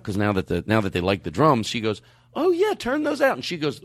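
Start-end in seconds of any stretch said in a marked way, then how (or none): 2.4-3.3 much higher, imitating the woman; pitched way up to mimic her; mock woman's voice. Quoting her to the audience, voice pitched way up.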